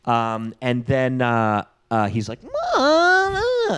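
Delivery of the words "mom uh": very high, mock kid shout; whiny call. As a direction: call out high to your mom. Kid calling out to his mom.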